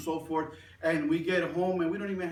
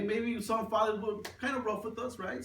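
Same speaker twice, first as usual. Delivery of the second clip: ordinary delivery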